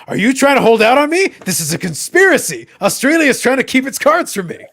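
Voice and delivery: over-the-top betrayed fan; mock outrage. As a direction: play it up like a victim of a grand plot. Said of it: "I'm like".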